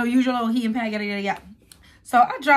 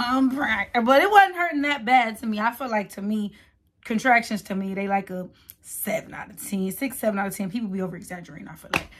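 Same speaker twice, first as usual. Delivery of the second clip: ordinary delivery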